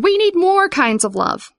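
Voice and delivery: high-pitched urgent appeal; crying out. A narrator's reading. Vivian urging the town, high insistent cry. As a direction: cry it out as a plea. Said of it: "Vivian cried".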